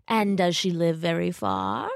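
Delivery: lightly voiced wolf; a hint of wolfish probing; sly wolf. A narrator's reading. Wolf probing where grandmother lives, lightly sly. Wolf questioning Little Red.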